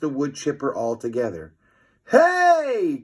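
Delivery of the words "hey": high, loud startled call; boy startled by the swerve; surprised cry. A narrator's reading. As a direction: shout in amazement. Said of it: "cried Fluffy".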